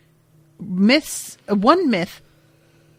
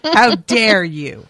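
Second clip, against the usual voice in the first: mock outrage; 7 dB louder, slower than usual. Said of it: "And I was like".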